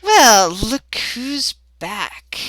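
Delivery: very slow, drawn-out menacing greeting; aggressive grin. Acted. Oberon spotting Tonka returned, slowly gloating. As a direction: draw it out through an unpleasant grin. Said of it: "one of those aggressive type grins".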